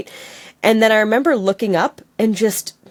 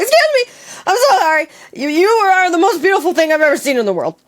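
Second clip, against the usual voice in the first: high, rushed nervous gushing; flustered high confession; awkward gush; 8 semitones higher than usual. Retold or imitated. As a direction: gush like you can't stop yourself. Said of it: "being a naturally awkward person".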